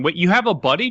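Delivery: usual speaking voice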